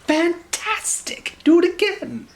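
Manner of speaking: high, gushing cheer, drawn out; excited shout. Acted. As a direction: egg the dragon on. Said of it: "Elizabeth shouted".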